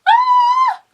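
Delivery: high, loud, thrilled shriek; a fan's delighted shriek; excited squeal. Real, not acted. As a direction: squeal with pure joy. Squeal after book is signed.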